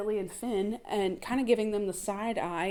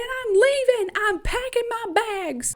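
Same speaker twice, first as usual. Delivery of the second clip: much higher, louder mock voice, drawn out; high mock Lizzie; 14 semitones higher, 5 dB louder, slower than usual. Lizzie telling Tommy she's leaving, high mock voice. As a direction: ham up the defiant wife. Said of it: "she says she's packed up".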